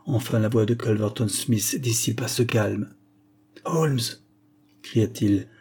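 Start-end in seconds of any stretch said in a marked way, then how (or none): 3.6-4.0 higher call of Holmes! to rouse a sleeper; calling to wake him. A narrator's reading. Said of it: "du ton d'un homme qui cherche à réveiller un dormeur".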